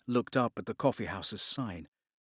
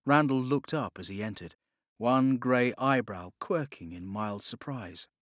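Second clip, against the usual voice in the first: plain reading voice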